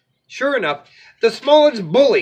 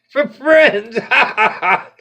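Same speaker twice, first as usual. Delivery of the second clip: mocking laugh; 6 semitones higher, 5 dB louder than usual. Goat mocks ogre.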